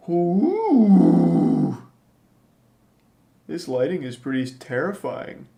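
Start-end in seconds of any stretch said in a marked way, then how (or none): 0.1-1.8 high, loud spooky "oooh"; mock ghostly wail. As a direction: howl like a playful ghost. Mock-spooky show for the viewers.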